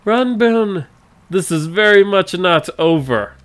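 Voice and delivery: high, loud parting threat; shouting villain. Acted. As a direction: bellow it while fleeing. Villain fleeing, calling out.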